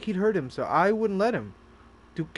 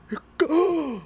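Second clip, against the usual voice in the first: gasp; 10 semitones higher, 3 dB louder than usual. Acted. Mock shocked reaction to the game.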